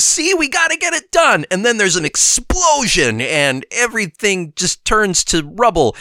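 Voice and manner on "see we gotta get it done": much higher, hurried Norman. Retold or imitated. Norman answering Kingpin in the recap, high mock panic. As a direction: squeak it out like a frantic scientist. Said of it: "And Norman says".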